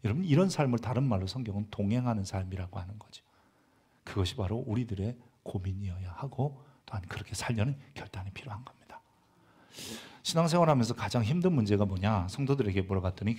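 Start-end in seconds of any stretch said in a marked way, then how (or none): 4.0-9.0 soft, falling preacher's murmur; hushed sermon close. Acted. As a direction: pull the room in quietly.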